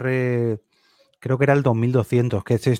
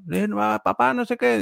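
a hint of a child calling to dad, pitched much higher; mild higher child's voice saying papá; lightly voiced daughter; 11 semitones higher than usual. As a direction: imitate the kid barging in. Daughter interrupting on call.

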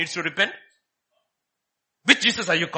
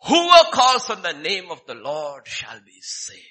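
slow, louder reading of Whoever calls on the name of the Lord; emphatic quotation; 8 dB louder, slower than usual. Acted. Preacher quoting Romans ten thirteen, slow emphatic. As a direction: lean on every word of the verse. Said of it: "a very emphatic statement".

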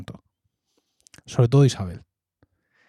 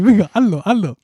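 put-on kids egging on; 6 semitones higher, 4 dB louder than usual.

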